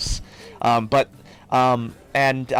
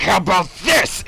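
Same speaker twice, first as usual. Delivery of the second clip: taunting half-orc; 9 semitones higher, 4 dB louder than usual. Retold or imitated. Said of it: "he's like".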